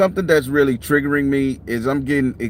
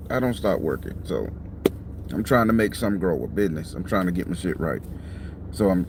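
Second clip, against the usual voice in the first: usual speaking voice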